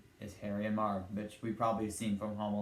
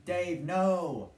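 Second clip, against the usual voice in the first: cartoon plea; 7 semitones higher, 5 dB louder than usual. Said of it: "Alvin tries by saying".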